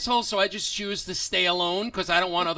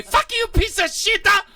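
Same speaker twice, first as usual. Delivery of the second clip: high, loud sing-song curse; mock parting scream; 11 semitones higher, 7 dB louder than usual. Passenger leaving the bus cursing the driver, loud mock yell. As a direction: fling it back over her shoulder, hammed up. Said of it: "As she was getting off the bus".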